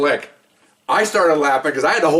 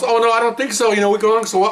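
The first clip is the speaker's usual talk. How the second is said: higher, mock-dejected protest; mock pleading; 6 semitones higher than usual. Dumped golf partner resisting the split, mock pleading.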